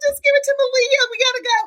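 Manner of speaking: high, loud urgent brush-off; frantic hurry. Retold or imitated. Mom hurrying out past the old lady, frantic. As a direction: cut her off, voice strained way up. Acts hurried exit over meltdown.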